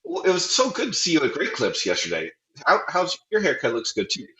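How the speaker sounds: friendly comeback. Retold or imitated. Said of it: "I should have said".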